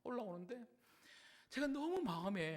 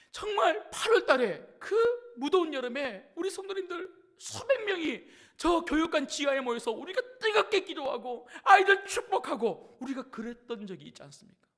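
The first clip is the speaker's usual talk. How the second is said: loud, high, impassioned appeal; fervent preaching; 6 semitones higher, 9 dB louder than usual. Real, not acted. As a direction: rouse the congregation at full voice. Preaches at full force. (뜨겁게 외치며 호소하는 설교)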